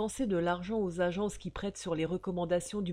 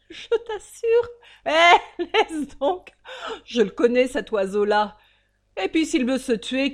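mocking laugh; 9 semitones higher, 12 dB louder than usual. Father scoffs, laughs loudly.

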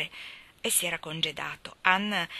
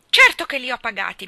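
loud, raised indignant retort; getting angry; 5 semitones higher, 12 dB louder than usual. A narrator's reading. Ivy insists she paid for the bows, angrily. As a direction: protest hotly, louder and higher. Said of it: "Ivy Trent montò in collera".

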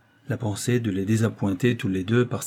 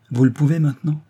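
higher, louder surprised query; astonished question; 5 semitones higher, 5 dB louder than usual. A narrator's reading. Young woman questions the doctor's change of position, astonished. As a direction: ask it in raised surprise. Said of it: "d'un air étonné".

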